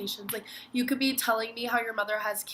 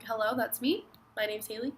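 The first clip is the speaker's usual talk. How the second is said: ordinary talk, nothing marked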